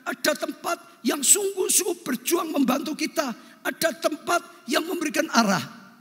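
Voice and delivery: high-pitched, ringing sermon delivery; impassioned preaching. Real, not acted. Preacher urging the congregation that help exists, impassioned preaching.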